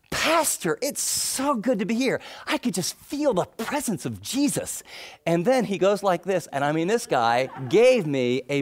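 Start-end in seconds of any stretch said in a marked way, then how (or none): 0.1-4.8 high-pitched, gushing imitation of the new convert; imitating an excited convert. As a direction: play him eager and starry-eyed, pitched up.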